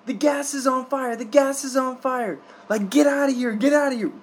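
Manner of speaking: high, urgent mock shouting of the translation; retold panicked yelling. Translating the neighbors' warning to the listener, mock panicked yell. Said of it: "what that means".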